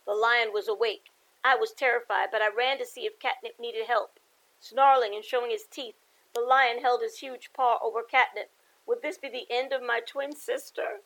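lively picture-book read-aloud; story narration. A narrator's reading. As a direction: read it to the kids with bounce.

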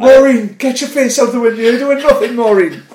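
high, loud mock telling-off; mimicked scolding. Mate re-enacting the bat video's telling-off, high mimicked scolding. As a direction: scold Maureen like the man in the clip. Quoting viral Irish family video.

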